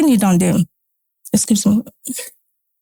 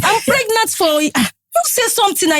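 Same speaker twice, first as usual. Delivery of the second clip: high-pitched urging; 8 semitones higher than usual. Retold or imitated. Pressing husband to speak.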